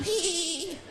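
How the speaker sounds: high-pitched imitated child giggle; nephew's giggle. Nephew cracking up at cartoon, mock high giggle. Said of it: "My nephew was sitting there cracking up".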